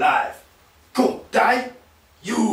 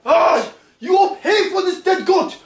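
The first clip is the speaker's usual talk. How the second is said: barking angry guard; 4 semitones higher than usual. Acted. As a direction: bark commands at the prisoner. Guard barks orders at the prisoner.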